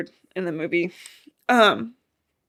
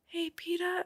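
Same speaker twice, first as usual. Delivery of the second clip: whispered; 11 semitones higher, 13 dB quieter than usual. Retold or imitated. Pranking whisper to wake.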